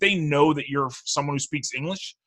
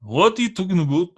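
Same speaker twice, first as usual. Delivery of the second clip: bad French Canadian accent; 4 semitones higher than usual. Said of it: "that was a bad accent".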